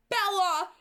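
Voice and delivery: high, loud cry of the name. A narrator's reading. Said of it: "the angel cried again".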